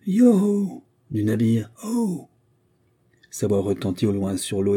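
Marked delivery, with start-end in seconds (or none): 0.1-1.7 high, loud call across the water; a sailor's hail carrying over the sea; hailing shout. Acted. 1.9-2.3 high call back from the ship; distant answering call from the deck; answering hail. Acted.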